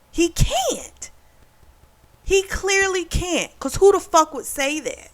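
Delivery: high-pitched disbelief; exasperated, high and drawn out; incredulous. Real, not acted. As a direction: insist on it, voice riding way up. Incredulous judgement of the boyfriend.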